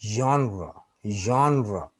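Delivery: जॉनरा repeated as zhon-ra, each falling at the end; correct genre pronunciation. Shown as a demonstration. Teacher echoing the English recording, जॉनरा as zhon-ra. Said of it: "इसका सही pronunciation".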